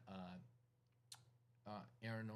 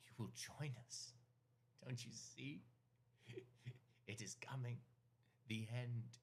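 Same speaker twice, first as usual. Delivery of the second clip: fanatic cult leader; 4 semitones higher than usual. Acted. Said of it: "he goes".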